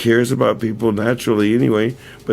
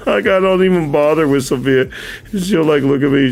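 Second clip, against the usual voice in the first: excited; 5 semitones higher, 3 dB louder than usual. Real, not acted. Excited retelling, raised pitch.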